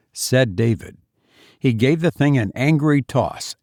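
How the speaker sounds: usual speaking voice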